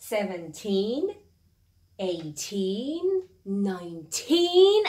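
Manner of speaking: put-on each -teen stretched and stressed. Shown as a demonstration. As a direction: lean on the teen in every number. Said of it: "تاکیدم روی تین هست". (تاکید روی «تین» در هر عدد)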